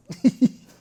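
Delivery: sudden high loud laugh. Real, not acted. Guest laughs hard retelling the teasing.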